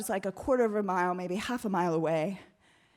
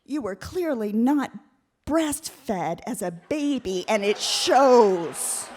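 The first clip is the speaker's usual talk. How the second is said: very slow, with pauses; savoring the best comeback; slow deadpan insult; 5 dB louder, much slower than usual. Retold or imitated. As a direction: land the punchline slowly. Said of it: "my favorite one".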